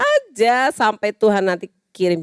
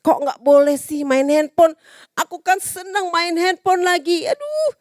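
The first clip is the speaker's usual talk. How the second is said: put-on complaining child; 8 semitones higher than usual.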